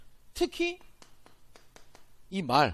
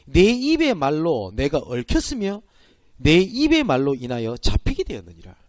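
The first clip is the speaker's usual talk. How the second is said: scripture reading; 4 semitones lower, 6 dB louder, slower than usual. Acted. Reads Proverbs verse aloud.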